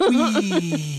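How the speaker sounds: a limp, laughing little wee; half-hearted wee. Retold or imitated. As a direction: cheer without any real excitement. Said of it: "half-hearted wee".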